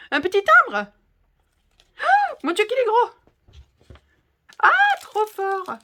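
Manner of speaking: high, loud gasps of delight; amazed, high and loud outburst; thrilled gushing. Real, not acted. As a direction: squeal over a surprise present. Thrilled unboxing of a gift.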